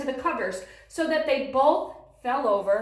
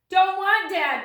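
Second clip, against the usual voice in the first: put-on higher, louder toddler bellow; bellowing baby; 9 semitones higher, 8 dB louder than usual. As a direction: bellow a refusal. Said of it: "bellowed the baby".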